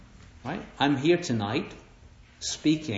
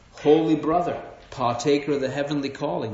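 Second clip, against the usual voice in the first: friend's scriptural greeting. Retold or imitated. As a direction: hail a brother in lofty scripture words. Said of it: "That's how he talks to me".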